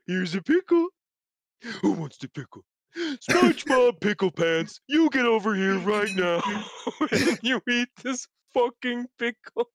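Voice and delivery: put-on cartoon voice, teasing; a touch of a pushy cartoon starfish; goofy cartoon bully. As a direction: boss him into eating it, cartoon style. Voicing Patrick pushing pickle.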